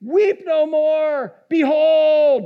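high, loud, drawn-out proclamation; commanding elder's cry. Retold or imitated. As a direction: command the weeper with booming, stretched words. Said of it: "He said".

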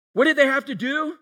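normal talking voice

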